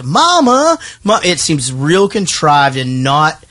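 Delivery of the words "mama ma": imitating Julia Roberts. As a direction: send up her Mama call as contrived. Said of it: "where she comes down and she".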